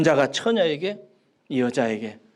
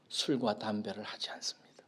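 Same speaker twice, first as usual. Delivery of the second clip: whisper; 9 semitones lower, 14 dB quieter than usual. Acted. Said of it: "whispered climax of story".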